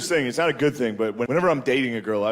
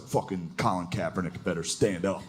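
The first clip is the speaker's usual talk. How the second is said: low, soft grumbled demand; hometown tweeter; 8 semitones lower, 7 dB quieter than usual. Acted. Someone from back home tweeting, low grumbling voice. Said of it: "someone from back home that's just like".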